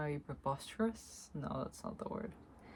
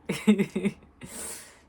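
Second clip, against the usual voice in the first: a loud, free burst of laughter; hearty spontaneous laugh; loud real laugh; 5 semitones higher, 11 dB louder than usual. Real, not acted. Laughing at spirit message.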